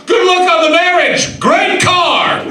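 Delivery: high, loud gleeful yell out the window; mock shout. Grandfather yelling from the limo window at the couple, loud and high. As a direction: holler it back at the stranded couple.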